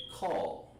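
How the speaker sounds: "call" drawn out slowly; slow made-up greeting. Shown as a demonstration. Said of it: "That's something I made up".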